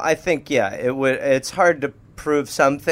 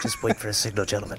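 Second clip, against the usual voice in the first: laughing hushed order; 9 semitones lower, 7 dB quieter, faster than usual. Acted.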